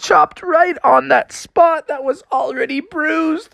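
high, drawn-out cartoon crying; whining wail. Shark chopped on his bruise, tearful wailing. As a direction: wail in mock agony, very high and stretched.

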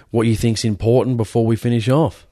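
ordinary talk

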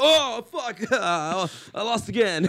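high, loud groan of defeat; mock frustration. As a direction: play a sore loser, hammed up. Acting out losing the imagined game.

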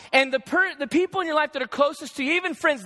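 normal talking voice

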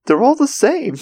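high-pitched retold protest; Jessie. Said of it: "Just Jessie just like".

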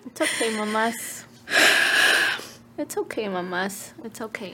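soft, hushed comfort; soothing. Real, not acted. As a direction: gently soothe a crying friend.

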